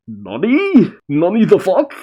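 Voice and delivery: high, hammed-up disbelief; mock shock. Narrator voicing a shaken rival commander, mock shock. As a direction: squawk it in comic stunned disbelief. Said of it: "shook. Like".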